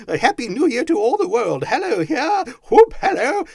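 high, loud jubilation building to whoops; giddy Scrooge. A narrator's reading. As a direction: shout greetings to all the world. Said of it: "laughing and crying in the same breath".

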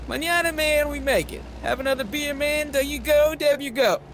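carefree, sing-song there you go; drunk Ricky. A narrator's reading.

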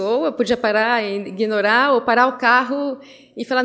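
ordinary delivery

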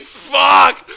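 high, loud exasperated curse; frustrated curse. Retold or imitated. Stuck waiting behind the old lady, loud frustrated curse. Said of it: "I was like".

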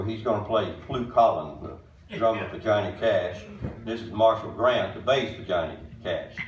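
ordinary talk

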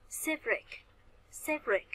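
"Shipwreck" hit on SHIP, falling on wreck; stress on first syllable. Shown as a demonstration. Teacher drilling students, "Shipwreck" stressed on its first syllable. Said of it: "shipwreck sẽ nhấn âm thứ, thứ nhất".

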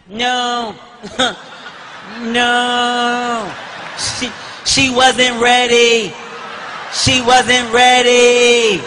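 slow, drawn-out sobbing words; mock crying. Son crying over his mom's casket, slow mock wail. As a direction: wail it slowly through fake tears.

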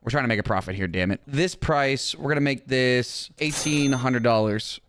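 ordinary talk, nothing marked

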